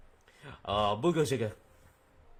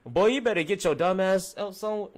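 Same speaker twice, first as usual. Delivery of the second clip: imitating his mom, higher and much louder, yelling at her son; angry mom; 10 semitones higher, 8 dB louder than usual. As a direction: play the furious mother scolding her boy at full volume. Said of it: "She was like".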